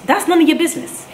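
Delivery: high, sharp indignant retort; indignant outburst. Real, not acted. To the nosy passenger on the flight, indignant high outburst. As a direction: fire it back, stung.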